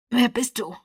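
pressed, pained voice; strained voice. A narrator's reading. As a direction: squeeze it out through pain. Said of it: "fragte er mit gepresster Stimme".

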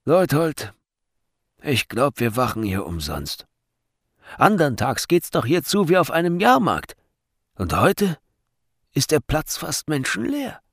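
a touch of grumpy griping; disgruntled guard. A narrator's reading. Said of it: "Missmutig wandte sich der eine".

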